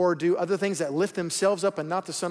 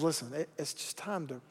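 much softer, lower and tender; gentle and kind; 5 semitones lower, 11 dB quieter than usual. Retold or imitated. God gently telling a straying believer to turn back, soft and kind. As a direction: coax them home gently, almost hushed. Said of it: "gently and kindly says".

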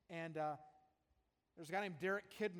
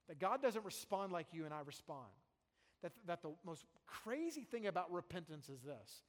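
ordinary talk, nothing marked

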